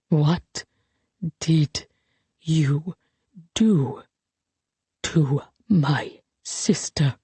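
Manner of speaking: slow, low, with long gaps between words; each word drawn out. Acted. Colette confronts him about her sister, drawing each word out. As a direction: lay each word down separately, menacingly. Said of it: "drawing each word out".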